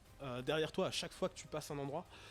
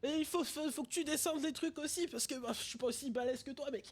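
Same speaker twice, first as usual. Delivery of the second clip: high little cat voice; 11 semitones higher than usual. Acted. GM continues cat character voice.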